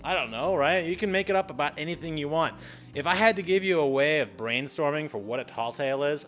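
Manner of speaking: usual speaking voice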